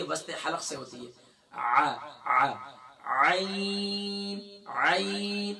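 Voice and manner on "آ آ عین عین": "عین" squeezed from the middle of the throat, drawn very long; ain sound demo. Shown as a demonstration. Teacher demonstrating the عین sound from mid-throat. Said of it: "عین کی ادائیگی گلے کے middle سے".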